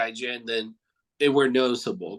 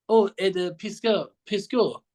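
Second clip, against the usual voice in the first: a higher-pitched imitation of a Swiss fan's accent; 5 semitones higher than usual. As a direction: mimic the starstruck Swiss fan in a light Swiss accent. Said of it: "I apologize for my accent".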